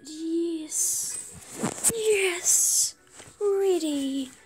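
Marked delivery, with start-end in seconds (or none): 2.0-4.3 high and loud cheer; excited yes. Real, not acted. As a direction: shout it as the trade goes through. Trade partner accepts.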